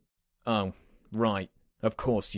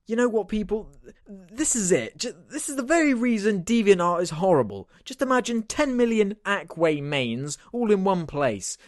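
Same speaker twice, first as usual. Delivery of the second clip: much higher, strained comic voice; higher put-on voice; 7 semitones higher than usual. Friend rants about the drawings in a high squeaky voice. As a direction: squeak it out in disgust. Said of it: "what is going on with your f***ing voice".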